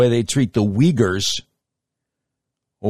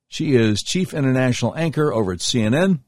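ordinary talk, nothing marked

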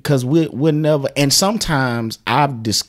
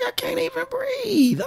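panicked thought; 8 dB quieter than usual. Retold or imitated. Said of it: "in my mind, I'm like".

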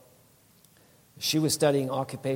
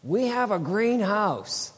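imitated wife blurting it out, much higher and slow; higher, slow, exuberant announcement; excited blurt; 9 semitones higher, 5 dB louder, slower than usual. Wife announcing to sister-in-law, imitated excited blurt. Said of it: "Pam blurts out".

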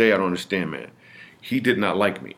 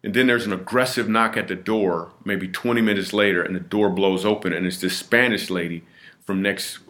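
ordinary talk

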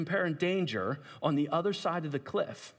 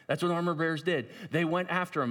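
ordinary delivery

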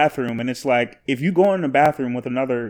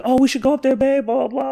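put-on high-pitched drunk girlfriend coaxing; playful, much higher mimicked voice; Amber pleading; 11 semitones higher than usual. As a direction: imitate a tipsy woman urging you along. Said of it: "But she was like".

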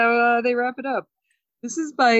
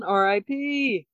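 ordinary talk, nothing marked

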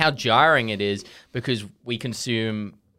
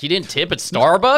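raised, higher-pitched indignant question; mock angry Americans; 7 semitones higher, 5 dB louder than usual. Angry Americans challenging him over tipping, mock indignation. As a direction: imitate an outraged American complainer. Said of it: "a hundred angry Americans tomorrow being like".

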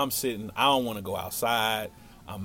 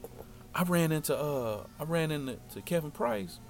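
halting, softer, pitch lifted; feigned casual; 4 semitones higher, 6 dB quieter than usual. Retold or imitated. Passive-aggressive boy fishing for news from his crush, feigned casual. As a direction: play it cool with stalling "uh"s. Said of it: "me being passive aggressive, trying not to seem like".

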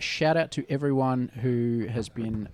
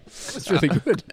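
ordinary delivery

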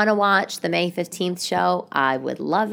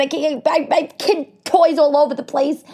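retold own protest; 11 semitones higher, 4 dB louder than usual. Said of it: "I said".

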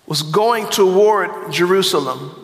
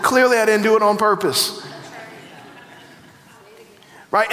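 ordinary talk, nothing marked

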